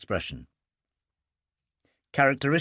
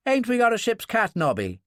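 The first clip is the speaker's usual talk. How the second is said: sailor's question; 7 semitones higher than usual. Retold or imitated. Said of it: "turns to his oppo and says".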